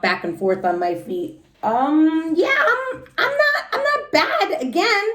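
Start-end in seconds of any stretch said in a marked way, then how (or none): 1.6-5.1 put-on high, halting, nervous kid; nervous stammer. Junie B. nervously telling the typing lady she's not in trouble. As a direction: stammer it out anxiously. Nervous child at principal's office.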